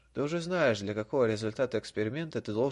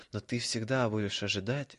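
ordinary delivery, nothing marked

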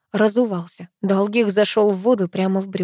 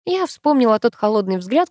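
ordinary delivery, nothing marked

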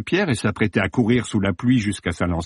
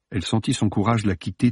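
plain reading voice

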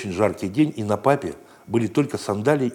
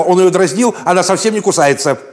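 loud, higher defensive shout; lady's shout; 8 semitones higher, 8 dB louder than usual. Acted. Dog's owner shouting to defend her pet, loud. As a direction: shout back defending the dog. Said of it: "Собачкина хозяйка кричала".

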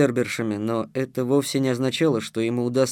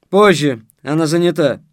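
curt refusal; 3 semitones higher, 8 dB louder than usual. A narrator's reading. Guard refuses his request.

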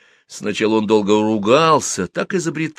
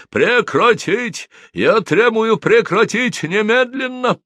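high, slow, drawn-out yell; screaming order; 4 dB louder, slower than usual. A narrator's reading. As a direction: bellow a furious demand at the crew. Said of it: "завопил". (вопит, требуя, высоко и протяжно)

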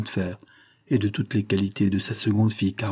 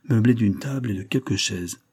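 usual speaking voice

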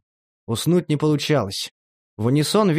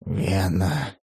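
much lower, softer, breathed out; astonished breath; 7 semitones lower, 3 dB quieter than usual. A narrator's reading. Demid breathes Vena's name in astonishment, much lower. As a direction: gasp his name in shock. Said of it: "изумлённо выдохнул Демид".

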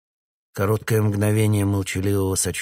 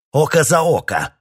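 vengeful shout; 9 semitones higher, 9 dB louder than usual. A narrator's reading. Colonel's vengeful outburst.

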